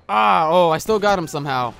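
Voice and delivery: loud startled exclamation; surprised. Real, not acted. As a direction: blurt out surprise. Surprised at headshot.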